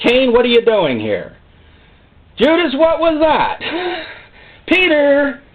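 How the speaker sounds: mock scolding calls, climbing; God calling out, rising. Preacher voicing God calling sinners to account. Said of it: "All he ever said was".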